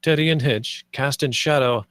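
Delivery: ordinary talk, nothing marked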